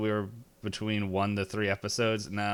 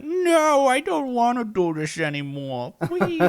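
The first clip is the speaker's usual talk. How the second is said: high, loud whiny plea, hammed up; mock whining player; 6 dB louder than usual. GM mocking players who begged mid-Pathfinder combat, high whiny plea. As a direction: whimper like a beaten player begging to stop. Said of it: "you guys are like".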